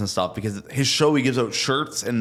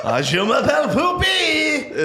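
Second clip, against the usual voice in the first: put-on high, slow French Canadian accent with a laugh; laughing hammed-up French Canadian; French Canadian Poopy; 4 dB louder, slower than usual. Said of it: "give me your French Canadian".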